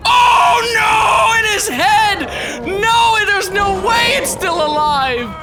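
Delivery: high, loud, shocked cries; shocked shouting. Real, not acted. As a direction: yell in disbelief at the gore. Spear hits animal's head.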